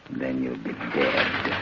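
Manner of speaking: soft, slow, quiet threat; menacing. Acted. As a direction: let the threat sink in slowly. Killer threatens his victim.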